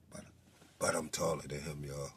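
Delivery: low, very soft mimicked mumble to avoid being heard. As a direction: keep it down so he can't hear. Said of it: "he lowered his voice and said".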